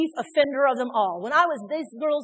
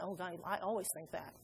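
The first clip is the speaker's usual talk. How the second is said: very soft, lowered aside; hushed admission; 5 semitones lower, 17 dB quieter than usual. Acted. Preacher voicing people in the room, hushed confession. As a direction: admit it under your breath.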